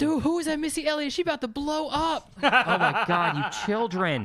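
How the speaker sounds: high, loud imitating a clueless kid; mock the confused younger crowd; clueless young fan. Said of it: "Did people actually say that?".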